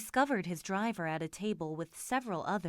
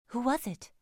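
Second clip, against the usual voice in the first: higher, slightly anxious lady's voice; a touch of worry, pitched up; anxious question; 4 semitones higher than usual. A narrator's reading. Lady to her companion after a passer-by, anxious. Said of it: "she asked anxiously".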